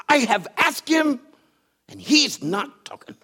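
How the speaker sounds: playing the daughter's huffy complaint; animated daughter. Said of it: "she's very animated".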